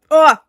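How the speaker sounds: sudden loud high yelp; startled yelp. Real, not acted. Streamer caught off guard by chat's deep-voice request, loud yelp. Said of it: "Can you do a deep voice?".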